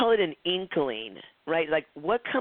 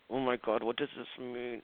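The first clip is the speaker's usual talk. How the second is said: much softer, murmured inward; said to oneself; 4 semitones lower, 8 dB quieter than usual. Shown as a demonstration. Showing students unspoken self-talk, she murmurs softly. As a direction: trail off into a private murmur. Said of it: "If I say that to myself".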